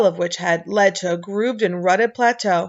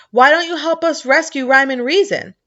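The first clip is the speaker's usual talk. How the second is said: shouting; 6 semitones higher, 5 dB louder than usual. A narrator's reading. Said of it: "shouted Milo again".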